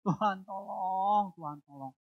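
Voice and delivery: pleading cry that fades to a murmur; plea starting high, dying away; desperate prayer. Retold or imitated. Saya berdoa terus sama Tuhan.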